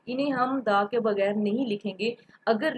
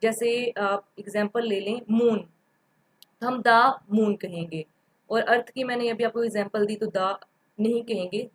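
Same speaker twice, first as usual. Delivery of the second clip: usual speaking voice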